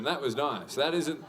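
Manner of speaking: usual speaking voice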